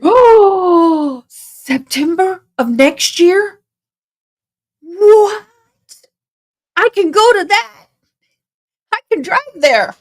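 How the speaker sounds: gasp, then high, loud and thrilled; real delight at news she can go; squealing with sudden excitement; excited surprise. Real, not acted. Learns CrimeCon comes near her.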